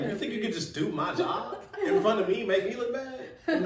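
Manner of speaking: loud, high-pitched playful tirade with laughter; mock outrage. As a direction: play an indignant server telling off a customer. Imagined angry server confronting customer.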